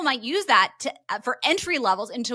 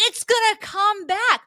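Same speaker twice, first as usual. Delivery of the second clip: high, slow insistent denial; mock denial; 10 semitones higher, 4 dB louder, slower than usual. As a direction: plead it much higher and slowly. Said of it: "she's like".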